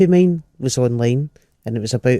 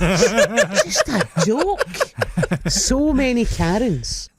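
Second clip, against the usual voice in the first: higher, slow laughing drawl; 7 semitones higher, much slower than usual. Real, not acted. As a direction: laugh through it, mocking. Mocking joke defence.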